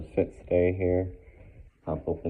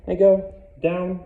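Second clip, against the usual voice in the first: firm command; 13 semitones higher, 10 dB louder than usual. Real, not acted.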